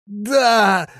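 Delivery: high, loud moaned yes; anguished groan; moaning. A narrator's reading. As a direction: wail the answer in misery. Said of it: "простонал Крепыш".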